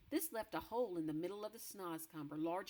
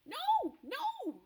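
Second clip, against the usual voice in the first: far higher, frantic denial; desperate cry; 9 dB louder than usual. Acted. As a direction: cry no in panic. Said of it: "cried the B F G".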